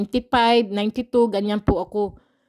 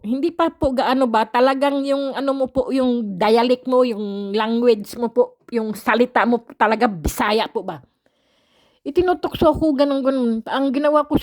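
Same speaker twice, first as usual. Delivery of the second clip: ordinary delivery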